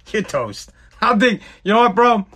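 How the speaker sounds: ordinary talk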